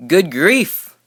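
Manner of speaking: loud, high good-grief groan; a loud, high fed-up groan; exasperated groan. A narrator's reading. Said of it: "groaned the ones who had stars".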